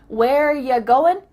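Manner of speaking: slow, loud "Where ya going"; deliberate reduced question; ya for you. Shown as a demonstration. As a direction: spell out "ya" by ear, slowly. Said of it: "changed it to ya".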